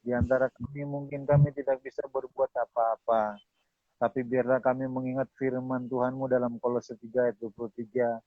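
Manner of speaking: subdued, measured closing prayer; public prayer. Real, not acted. Pastor closing the session before God, prayerful. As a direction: lead them in reverent prayer, phrase by phrase.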